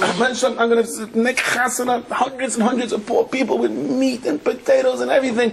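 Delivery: rising, desperate vows, pitched high; frantic high pleading. Retold or imitated. Sailor in the storm promising God a feast, frantic high pleading. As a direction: bargain with God like the ship is going down.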